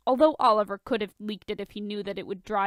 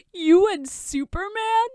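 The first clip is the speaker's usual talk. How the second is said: incredulous; 11 semitones higher, 7 dB louder than usual. Acted. Tony shocked, drunk.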